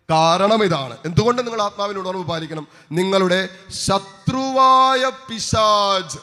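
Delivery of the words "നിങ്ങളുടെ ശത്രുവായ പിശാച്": slow, drawn-out pulpit cry; ringing, sustained preacher's call; scripture proclamation. Real, not acted. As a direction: stretch each word out loudly for the congregation.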